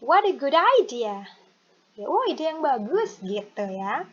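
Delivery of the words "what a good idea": "what a good idea" joined smoothly, loud; linked words. Shown as a demonstration. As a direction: model "what a good idea" without gaps. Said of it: "Digabung aja". ("what a good idea" digabung mulus)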